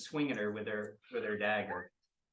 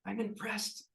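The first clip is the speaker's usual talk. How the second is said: higher, seething praise that ends loud; impressed but furious villain; grudging villain; 11 semitones higher, 6 dB louder, slower than usual. Acted. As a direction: admit she's impressed, then spit out the anger. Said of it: "you hear".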